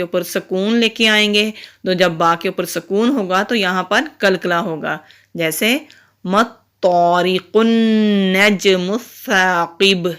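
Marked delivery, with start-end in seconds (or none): 6.2-10.1 الثَّاقِبُ ending with a bouncing, echoing ba; echoing final ba. Shown as a demonstration. Teacher demonstrates qalqala at the stop. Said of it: "اس کو کلکلا کہتے ہیں".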